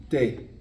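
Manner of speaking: te said as Spanish "teh"; te, with a pure short Spanish e; te pronounced the Spanish way, "teh"; Spanish letter name. Shown as a demonstration. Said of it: "T, E".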